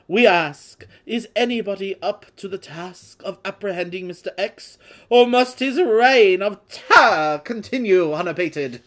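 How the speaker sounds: loud dramatic British news-report finale; an over-the-top British newsreader voice; bad British accent. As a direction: boom out the closing question like a British town crier. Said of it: "I'm just gonna do it".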